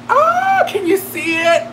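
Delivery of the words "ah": squealing cry. Real, not acted. Putting on the pin.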